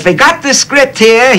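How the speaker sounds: slow, high mock Austrian-German accent; Billy Wilder's accent. Lemmon imitating Wilder's Austrian-German accent pitching the script. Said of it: "Katzenjammer Kids accent".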